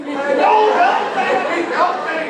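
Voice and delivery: high, loud shouting back; hammed-up crowd yelling; mock shout. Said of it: "They're like".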